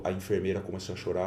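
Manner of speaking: usual speaking voice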